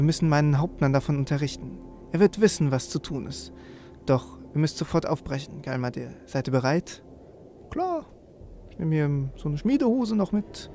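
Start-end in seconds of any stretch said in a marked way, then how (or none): none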